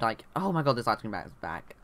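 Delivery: ordinary talk, nothing marked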